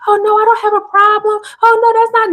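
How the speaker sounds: high, fast, innocent denial; gaslighting voice. Retold or imitated. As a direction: play the fake-innocent denier. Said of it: "they're gonna try to do is gaslight you".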